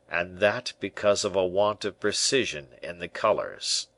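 ordinary delivery, nothing marked

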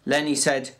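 plain reading voice

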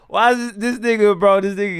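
ordinary talk, nothing marked

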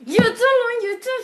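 very high, loud, slow furious shout; rage scream. Real, not acted. Gamer dying at the boss, screaming in rage.

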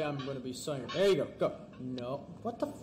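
usual speaking voice

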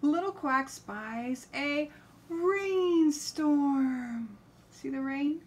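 slow, high, loud storybook voice; reading to a toddler. Acted. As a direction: draw out the words for little ones. Slow animated read-aloud.

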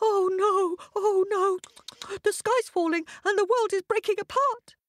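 high panicky hare voice; panicked hare. A narrator's reading. Terrified hare crying out that the sky falls, high panic. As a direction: squeak it out in panic. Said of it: "Hare's heart thumped".

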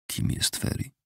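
usual speaking voice

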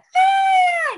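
piercing, very high loud squeal; long high-pitched scream, played up; imitated rat scream. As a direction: shriek like an outraged rat. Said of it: "Y grita".

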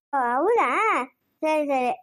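usual speaking voice

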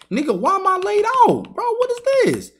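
screeching high disbelief at the missed shot; incredulous high yelling. Real, not acted. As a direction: voice jumps way up, loud disbelief. Raging at opponent's missed shot.

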